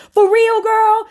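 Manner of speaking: a loud, high-pitched shocked gossip reaction. Acted.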